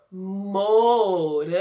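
"Morango" slowly as mo, ran, go; split into syllables. Shown as a demonstration. Teacher introducing the strawberry word, "Morango" sounded out slowly in syllables. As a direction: separate and draw out the syllables of "Morango". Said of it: "Morango começa com a sílaba".

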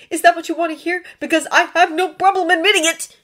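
high, loud, irritated retort; snappish. A narrator's reading. Draco snapping at Hermione, defiant. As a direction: throw the admission at her. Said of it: "Draco snapped".

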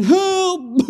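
high, loud drowning cry; screamed cry for help. Acted. Preacher acting out a drowning person, screaming for help. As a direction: yell it out high, like someone going under. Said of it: "they're screaming".

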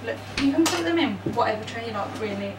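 normal talking voice

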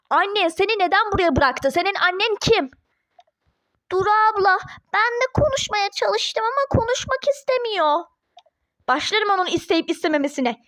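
ordinary talk, nothing marked

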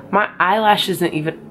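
ordinary delivery

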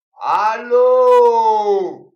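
loud, high, long yell; shouted hello. Retold or imitated. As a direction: bellow it as if the line is dead. Calling out on phone.

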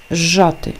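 «зжати» starting on one long zh instead of z-zh; lengthened zh. Shown as a demonstration. Teacher gives an example of the rule, lengthened zh. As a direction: hold the opening of «зжати» as zhzh. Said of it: "подовжений".

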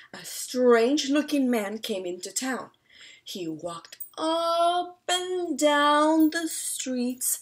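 plain reading voice

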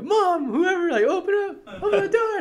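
high, frantic call through the door; desperate yell. Retold or imitated. Boy at the screen door yelling for mom, frantic high call. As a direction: shout for anyone to open up, panicked. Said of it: "and I'm like".

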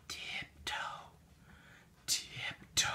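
hushed whisper, barely a breath. Acted. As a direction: whisper it so the bear can't hear. Sneaking into the bear's cave.

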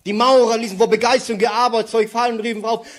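usual speaking voice

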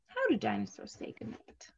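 soft unvoiced whisper of the title; hushed storybook whisper. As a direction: whisper it like a secret. Reading title to children.

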